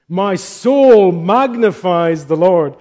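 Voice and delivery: slow and loud, proclaiming each word; fervent declaration. Real, not acted. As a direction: lift the translation up like praise. Translating the Latin with fervour.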